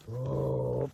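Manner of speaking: ordinary delivery, nothing marked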